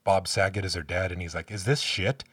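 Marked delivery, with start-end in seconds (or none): none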